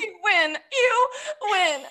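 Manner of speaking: high wailing fake sobs; mock sobbing. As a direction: wail it like a fake cry. Mock sobbing apology video.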